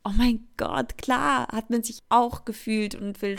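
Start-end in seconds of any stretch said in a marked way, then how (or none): none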